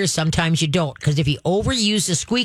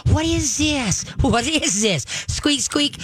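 much higher, excited call; dog cheerleader; 7 semitones higher, slower than usual. Shown as a demonstration. Trainer luring the dog's head, high and excited. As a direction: grab the dog's attention playfully. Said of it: "go touch the corner of a couch".

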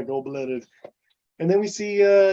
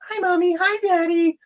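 put-on high-pitched, sing-song happy girl; Tammy. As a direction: greet the parents in a put-on girly voice. Voices happy Tammy greeting parents.

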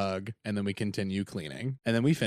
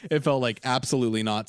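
normal talking voice

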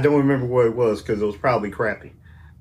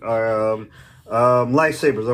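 normal talking voice

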